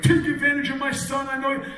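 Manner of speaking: a hint of a suspicious Brooklyn father; imitating his Brooklyn father. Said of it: "And he could be like".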